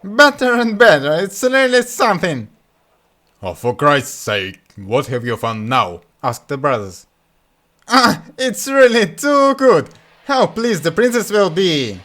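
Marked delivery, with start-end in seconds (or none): 0.0-2.5 high, loud gleeful fool; excited Butthead. Acted. Butthead gloats. 3.4-6.0 slow, lower fed-up groan; exasperated brother. Acted. 7.9-12.0 high, loud, slow glee; delighted Butthead. Acted.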